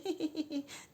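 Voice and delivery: high put-on giggle; imitated laugh. Woman on the phone with her caller, put-on laugh. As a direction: giggle as if politely flattered. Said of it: "I now laugh. I say".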